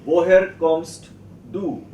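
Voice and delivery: drawn-out language-class question; slow teacher modelling. Shown as a demonstration. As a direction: project it slowly for beginners.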